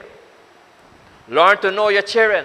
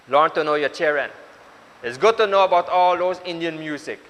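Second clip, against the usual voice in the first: usual speaking voice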